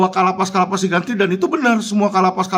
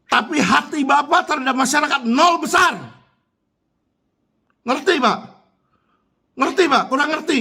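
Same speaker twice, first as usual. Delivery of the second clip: higher-pitched, heated accusation; indignant rebuke; 6 semitones higher than usual. Real, not acted. Citizen scolding an official over his heart for the people, indignant. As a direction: throw it at him in indignation, pitched up.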